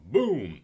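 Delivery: explosion sound. Retold or imitated. Describing Starkiller Base blowing up with a loud boom. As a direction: blurt a big explosion.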